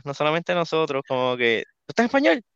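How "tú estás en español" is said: high, incredulous question; acted-out disbelief, pitch jumping up; startled audience reaction. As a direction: blurt it out like a startled moviegoer. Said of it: "yo audiblemente, varias personas".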